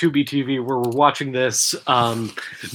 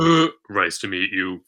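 much lower, lazy Sans voice; Sans impression; 10 semitones lower than usual. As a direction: mumble it low like Sans. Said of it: "incredible Sans impression".

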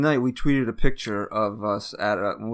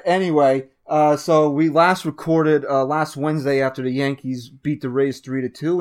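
ordinary delivery

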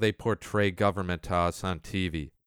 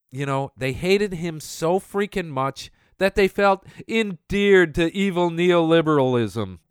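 normal talking voice

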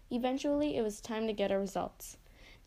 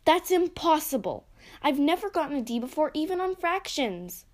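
shocked outburst; 5 semitones higher, 6 dB louder than usual. Acted. Girl shocked at D grade.

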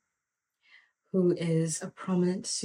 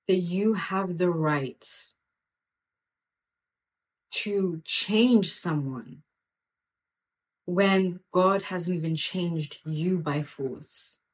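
slow, weighty; slower than usual. Real, not acted. Preacher challenging listeners who try changing others, slow and weighty. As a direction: drag each phrase out slowly.

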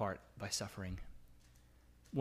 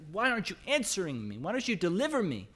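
higher, pleading questions to God; imitated crying out; 6 semitones higher, 3 dB louder than usual. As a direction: act out a mild cry of complaint. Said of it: "so he cries out".

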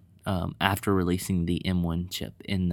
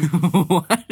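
laughing; 10 semitones higher, 10 dB louder than usual. Real, not acted. Laughing at friend's intro.

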